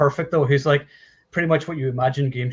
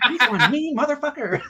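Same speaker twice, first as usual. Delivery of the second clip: higher swearing granny voice; foul mouthed granny; 10 semitones higher than usual. Retold or imitated. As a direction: growl it like a salty old lady. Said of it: "this she's like".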